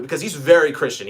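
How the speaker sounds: ordinary talk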